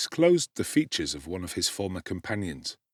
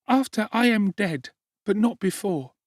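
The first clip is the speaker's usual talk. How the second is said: put-on small child Dick; 11 semitones higher than usual. A narrator's reading. Sick little Dick answering Oliver, high child voice. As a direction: answer as a frail small boy.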